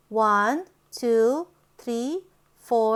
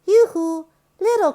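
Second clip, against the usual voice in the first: louder sing-song yoo-hoo; calling out; 6 semitones higher, 6 dB louder, faster than usual. Acted. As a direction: call out brightly. Calling out to calf.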